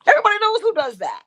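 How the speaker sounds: imitating a sassy older woman's pointed remark; her catchphrase. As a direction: quote her famous line with sass. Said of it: "the other phrase she was so popular for".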